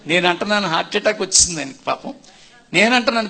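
ordinary delivery

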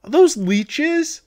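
high, slow, horrified; being chased. Real, not acted. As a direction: dread as they crawl close.

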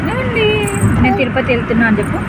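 high, loud sing-song coaxing. Real, not acted. Coaxing child to greet viewers. (పిల్లకు నేర్పిస్తూ ముద్దుగా చెప్పడం)

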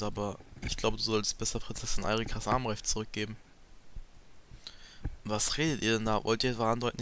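lightly voiced characters in a dramatic read; reading game dialogue. Player reading a Fire Emblem cutscene aloud, lightly voiced dramatic read. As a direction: read the cutscene lines like a dub.

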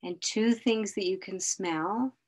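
slow, calm grounding guide; guided meditation. Acted. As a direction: lead them slowly through the senses. Leads a grounding exercise.

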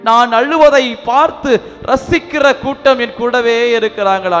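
ordinary talk, nothing marked

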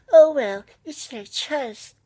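mock a shrugging bystander; mocking shrug. Mocking what people expect him to say, high sarcastic sing-song. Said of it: "I'm just supposed to go".

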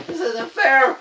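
high, drawn-out helpless whine; whimpered protest. Acted. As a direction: let the wail shrink to a whimper.

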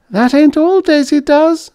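high squeaky voice, sour edge; bitter squeaky old lady. A narrator's reading. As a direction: sour little granny squeak. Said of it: "Missus Quimby said with bitterness".

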